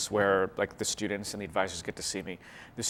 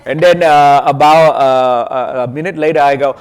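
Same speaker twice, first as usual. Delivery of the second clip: put-on Vietnamese accent; 4 semitones higher, 19 dB louder than usual. Comedian setting up his accent reveal, put-on Vietnamese accent. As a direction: stretch out the fake Vietnamese accent, loudly. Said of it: "I'm just kidding".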